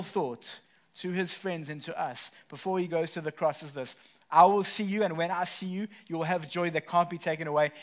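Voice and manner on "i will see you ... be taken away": loud, high preaching burst. Acted. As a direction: proclaim it to the whole church. Preaching Jesus' promise at full force.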